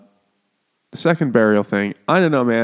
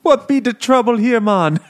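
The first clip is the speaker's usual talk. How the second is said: high, loud mock Jamaican; exaggerated comic Jamaican voice; Jamaican accent; 12 semitones higher, 6 dB louder than usual. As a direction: ham up a sing-song Jamaican accent. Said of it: "Why are you speaking like that?".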